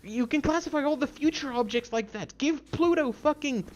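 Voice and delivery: high, heated tirade; angry rant. Real, not acted. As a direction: lash out in outraged indignation. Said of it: "I did not expect to get this angry".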